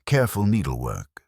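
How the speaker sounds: plain reading voice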